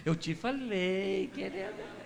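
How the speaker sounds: slow, soft told-you-so with a hint of a smug husband; husband quietly rubbing it in to his wife. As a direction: let it drip out slowly and softly. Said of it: "Marido gosta de falar isso pra esposa".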